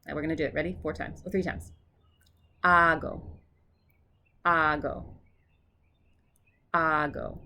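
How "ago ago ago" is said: "Ago" with the opening syllable drawn out; stress on first syllable. Shown as a demonstration. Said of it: "The emphasis is on the first syllable".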